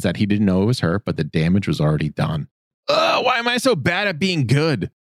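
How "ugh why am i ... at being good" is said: pitched way up in exasperated despair; frustrated yell. A narrator's reading. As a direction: groan it out at the sky, fed up. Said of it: "Zuko yells into the night".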